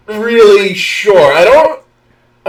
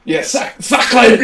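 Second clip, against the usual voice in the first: put-on hammy French accent; mock-French exclamation; 2 semitones higher than usual. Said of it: "that is very French".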